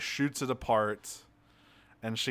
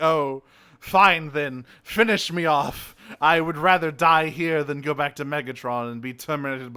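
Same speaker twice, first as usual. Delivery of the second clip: hammed-up defiant surrender, loud; melodramatic surrender; 2 semitones higher, 7 dB louder than usual. Beaten villain daring her to finish him, melodramatically.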